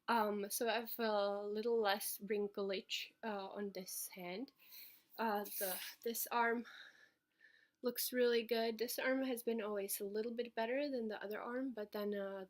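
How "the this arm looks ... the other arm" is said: ordinary talk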